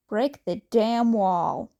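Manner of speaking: usual speaking voice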